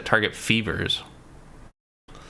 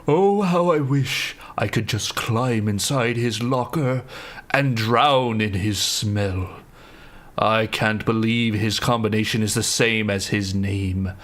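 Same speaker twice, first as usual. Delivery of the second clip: swooning; slower than usual. Acted. Swooning over locker note.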